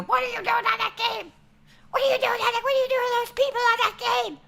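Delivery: imitating mom calling from the couch; mom yelling. Streamer imitates his mom yelling about his game.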